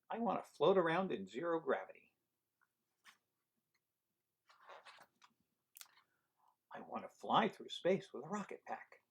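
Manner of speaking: lively storybook read-aloud; reading to children. Acted. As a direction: read it to a room of kids. Reading picture book aloud.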